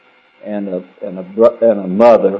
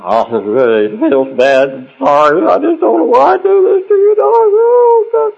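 put-on slobbering drunk; 3 dB louder than usual. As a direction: whimper it out like a sloppy drunk full of self-pity. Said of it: "in the slobbering part".

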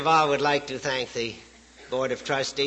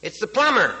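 lightly acted call through a door, pitched up; plumber calling out; 6 semitones higher, 4 dB louder than usual. Plumber answers the parrot through the door, mild call-out. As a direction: raise the voice a little to be heard inside.

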